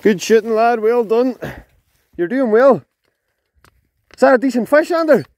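high, loud praise shouted across the water; calling out. Real, not acted. Angler encouraging his friend landing a trout, loud call. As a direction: cheer him on from a distance.

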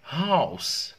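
"house" with a clear breathy h, loud and high; spoken H in "house"; pronounced H. Shown as a demonstration. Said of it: "هنا راح تكون house".

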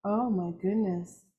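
plain reading voice

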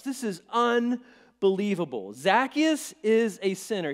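grumbling crowd. Retold or imitated. The crowd grumbling about Zacchaeus, slow and loud. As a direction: drag it out in outraged disbelief. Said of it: "they all started grumbling".

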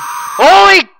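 high loud startled yell; startled shout. Real, not acted. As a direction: jump out of your skin. Sudden jump scare.

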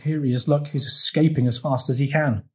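usual speaking voice

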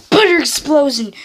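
usual speaking voice

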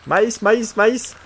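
high teasing chant of maïs; singsong mock. Streamer teasing the corn character in a singsong. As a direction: chant "maïs" like a playground taunt.

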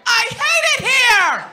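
put-on high whiny teen girl yelling at dad; mock sitcom teen tantrum; bratty daughter. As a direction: play the sulky daughter storming off. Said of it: "she's like".

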